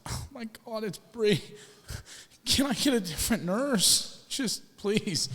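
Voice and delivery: high-pitched, imitated whimpering plea; mock tearful plea. Patient mortified that his first kiss is his nurse, mock tearful plea. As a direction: beg in a high voice, mock distressed. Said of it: "crying and just, like, depressed".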